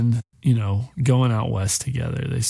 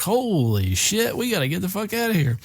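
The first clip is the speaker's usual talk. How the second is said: higher-pitched, hammed-up panic without raising volume; mock alarm; 7 semitones higher than usual. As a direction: play at fleeing gunfire. Mimics men under rifle fire.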